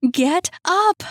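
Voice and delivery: desperate yelling. A narrator's reading. Trapped woman yelling at the fallen Jacob, frantic high shout. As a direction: scream at him to get up off the floor. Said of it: "She was yelling now, desperate".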